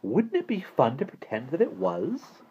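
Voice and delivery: oddly. A narrator's reading. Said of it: "he added oddly".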